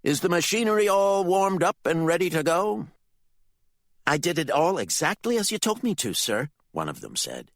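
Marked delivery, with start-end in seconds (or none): 0.0-2.9 high, loud shout to followers; commanding, raised-voice call. A narrator's reading. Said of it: "called out to his followers". 4.1-6.5 high, quick subordinate's reply; hurried, obedient henchman; eager follower. A narrator's reading. Follower reports to his leader.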